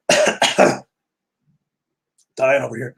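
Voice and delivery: strained voice after coughing fit; weak, choked words after coughing; choking on water. Real, not acted. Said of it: "choking on water".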